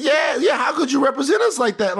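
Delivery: mock indignant shout. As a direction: shout it like an angry voter. Echoes the co-host's mock question.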